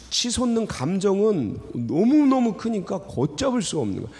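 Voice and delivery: ordinary talk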